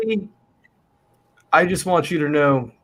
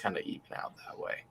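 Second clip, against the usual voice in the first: whispered aside, fast; 7 semitones lower, 19 dB quieter, faster than usual. Real, not acted. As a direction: drop to a quick whisper. Trailing aside.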